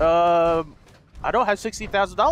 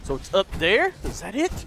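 ordinary delivery

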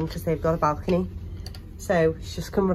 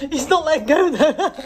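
high, loud laughing words; 9 semitones higher, 7 dB louder than usual. Real, not acted. Baby biting lime, laughing.

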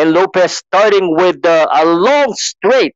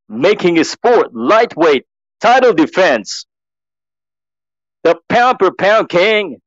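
Mark Chinook; 5 semitones lower than usual. Acted. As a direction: ring announcer building to long held words. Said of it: "I'm just copying Mark Chinook".

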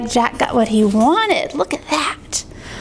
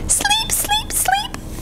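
tiny, high whispered plea; tired whisper; 4 dB quieter, much slower than usual. Acted. Tired babies to Jack, high soft whisper. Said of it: "tired babies finally whispered".

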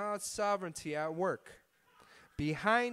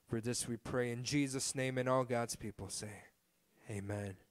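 congregational prayer; 3 semitones lower than usual. Real, not acted. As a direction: lead the people in prayer, easing down to the Amen.

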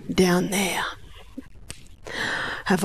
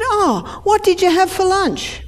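curious question; 10 semitones higher than usual. Retold or imitated. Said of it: "I said".

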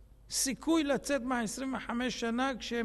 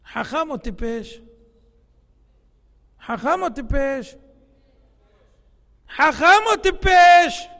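building to a shout; 2 semitones higher, 13 dB louder than usual. Real, not acted. Preacher building up to shouting his question at the congregation. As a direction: ask it three times, louder each time until you are shouting.

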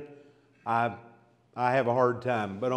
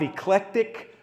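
usual speaking voice

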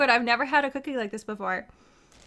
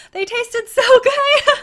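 much higher and louder, laughing through the words; slow, laugh breaks into the line; 12 semitones higher, 10 dB louder, slower than usual. Real, not acted. Streamer thanks Not Jen for cookies, laughing delight.

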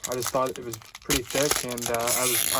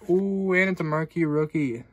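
excited; 4 semitones higher, 7 dB louder than usual. Real, not acted. Pulls a marquee rookie card.

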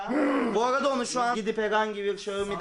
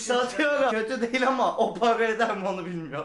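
usual speaking voice

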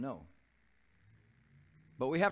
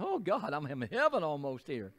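delighted exclamation; 3 semitones higher, 5 dB louder than usual. Retold or imitated. Remembered delight at being back home.

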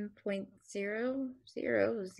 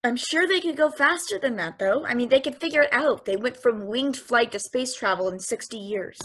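louder, pushing back hard; insistent raised voice; 9 dB louder than usual. Acted. Machine arguing with its waker, loud and insistent. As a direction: argue your point, voice raised. Machine protests against the limit.

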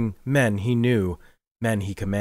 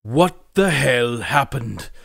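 growling; 4 semitones higher, 5 dB louder, slower than usual. Acted. Morgrig demands from Dirk what happened, slow angry growl. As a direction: demand it through your teeth. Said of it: "growled Manus Morgrig".

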